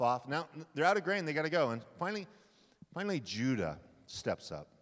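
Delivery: usual speaking voice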